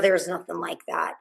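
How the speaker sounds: ordinary talk, nothing marked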